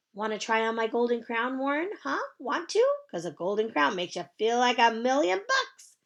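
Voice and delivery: put-on high, bright child's greeting; chirpy little girl. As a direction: sing it out like a cheerful kindergartner. Junie B. character voice.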